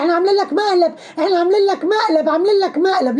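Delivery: high-pitched, insistent mock announcement; imitated excited reassurance; acted prank reveal. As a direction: repeat it up high, as if getting through to someone worked up. Acting out the prank being revealed.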